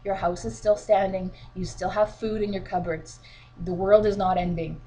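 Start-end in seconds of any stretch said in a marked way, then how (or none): none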